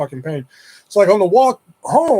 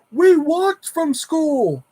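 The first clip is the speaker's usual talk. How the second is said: high, slow shout across the street; calling back; 11 semitones higher, much slower than usual. Retold or imitated. Boy answers the neighbor across the street, high drawn-out call. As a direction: call it long so she hears from far off.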